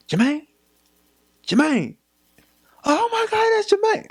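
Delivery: high, distraught mother calling out; a mother seeing her son cuffed; mock frantic mom voice; mom's shock. Mom spotting son in handcuffs.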